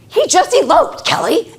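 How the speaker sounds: high, loud alarmed cry; a stressed teacher telling a visitor that a student has run off; panicked teacher. Retold or imitated. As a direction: blurt it in a panic to a colleague. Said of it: "this teacher was pretty upregulated".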